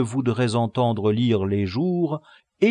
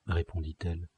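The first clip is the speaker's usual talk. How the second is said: usual speaking voice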